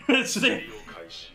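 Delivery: airy high laugh; a burst of breathy laughter. Real, not acted. Laughs at the twist.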